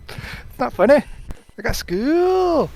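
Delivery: high, slow attempt at a Scottish girl's accent; deliberate try at a Scottish accent. Shown as a demonstration. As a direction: do your best Scottish accent for the little girl. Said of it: "I'm gonna try and do Scottish accent".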